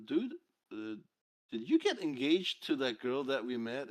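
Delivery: normal talking voice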